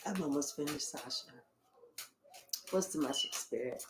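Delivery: quiet aside. Real, not acted. Reader quietly asking Spirit for the message, soft and low. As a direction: drop to a private whisper. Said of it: "aside to Spirit".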